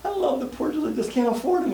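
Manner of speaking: high-pitched mock-crying confession; tearful customer. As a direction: imitate a client bursting into tears. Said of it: "She burst into tears".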